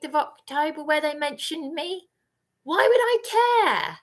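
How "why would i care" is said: scornful. Real, not acted. Dismissive outburst.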